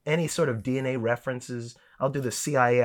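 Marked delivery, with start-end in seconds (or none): none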